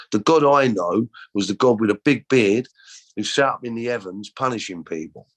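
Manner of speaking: usual speaking voice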